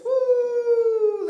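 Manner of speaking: long high, loud "woo"; drawn-out cheering woo; excited whoop. Real, not acted. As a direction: whoop to fire up the crowd. Host hyping up the crowd.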